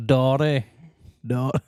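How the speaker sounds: Fermanagh border accent, Dotty said with a flat broad vowel. Shown as a demonstration. Showing how border folk say daddy, Fermanagh border accent. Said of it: "They're very raw".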